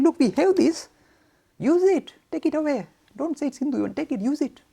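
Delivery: high, loud, urging; urgent offer. Retold or imitated. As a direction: press the gift on them eagerly. Retold offer to other faiths.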